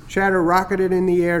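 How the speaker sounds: ordinary delivery, nothing marked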